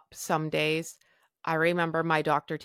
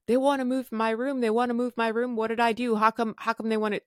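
higher, rushed mock panic; panicked phone rant; 6 semitones higher than usual. Panicking to her husband about moving rooms, higher and rushed. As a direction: gabble it up high like a panicked patient. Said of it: "I'm phoning my husband and I'm like".

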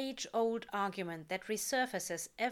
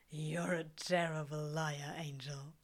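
low fond tease; 5 semitones lower than usual. A narrator's reading.

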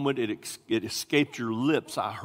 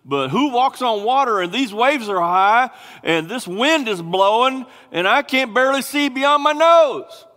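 higher and louder, arguing with himself in disbelief; incredulous Peter; 9 semitones higher, 7 dB louder than usual. Acted. Peter at the boat's edge, doubting what he sees, incredulous. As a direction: build the doubt as the wind howls. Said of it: "Peter's looking over the edge of the boat going".

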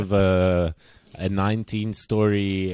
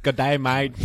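broad, teasing Australian greeting, a touch raised; mock Australian accent; 4 semitones higher than usual. Host mocks Australian viewers after dissing their accent, mock Australian accent. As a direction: parody an Australian saying hello. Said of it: "fuck Australian accents".

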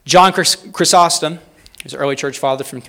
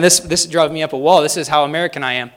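ordinary talk

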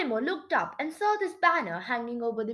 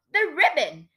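higher, louder, exasperated prompt; narrated exasperation, raised voice; exasperated squeak; 4 semitones higher, 5 dB louder than usual. A narrator's reading. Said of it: "Carol squeaked, exasperated".

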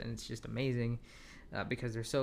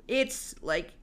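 after laughing hard; 4 semitones higher, 5 dB louder than usual. Real, not acted. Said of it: "let me collect myself".

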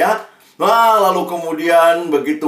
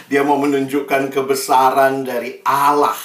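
usual speaking voice

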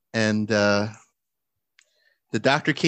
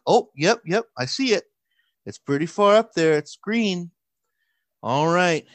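nurse voice; 6 semitones higher, 4 dB louder than usual. Retold or imitated.

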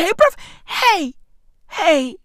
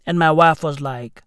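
ordinary talk, nothing marked

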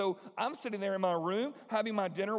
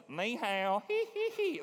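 mangled Ni hao with a high squeaky giggle after; mock a clumsy Southern Ni hao; bad country-boy Chinese; 6 semitones higher, slower than usual. Mocking the country boys on his team, hammed-up bad accent. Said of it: "it sounded like this".

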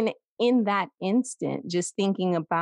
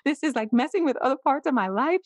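high, fast, pleading mock protest; protesting to mom; 7 semitones higher, faster than usual. Still pleading with her mom about early menopause, high and fast. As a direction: rush it out, retold distress in a high voice. Retelling a call to her mother.

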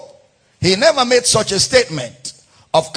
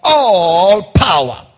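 proclaimed quote; 7 dB louder than usual. Acted. Preacher declaiming Jesus' words to the church.

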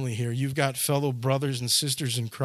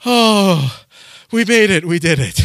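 relieved exclaim; 5 semitones higher, 10 dB louder than usual. Retold or imitated. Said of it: "the train is like".

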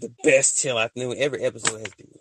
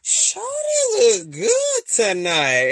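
high, slow, stretched-out admiring "Shawty look good tonight"; drawn-out impressed admiration; high, slow admiring drawl; 6 dB louder, much slower than usual. Retold or imitated. Admiring quote while viewing photo.

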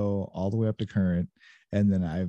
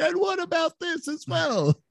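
very high, drawn-out mocking imitation; badgering host impression. Guest teasing host about drowning guests out, mock badgering. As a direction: mimic a pushy interviewer, high and slow. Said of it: "drown 'em out and stomp over 'em".

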